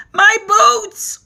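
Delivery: high, loud dismayed cry; cried out. A narrator's reading. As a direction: wail over the ruined boots. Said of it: "cried the centipede".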